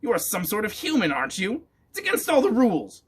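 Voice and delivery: shouting witch. A narrator's reading. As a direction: shout it like a rule-bound witch. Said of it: "Grimalda was shouting".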